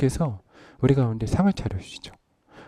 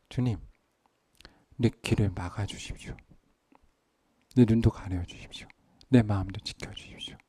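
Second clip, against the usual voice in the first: humble prayer; 4 semitones lower, 9 dB quieter than usual. Acted.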